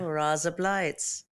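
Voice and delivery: slow, put-on Aussie vowels; soft, drawn-out Australian twang; Australian accent. Shown as a demonstration. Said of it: "giving us her, her, um, Australian accent".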